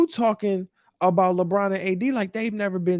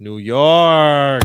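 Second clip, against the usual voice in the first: New York called out much louder; exasperated call; 3 semitones lower, 10 dB louder than usual. Real, not acted. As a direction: cry out the name in despair.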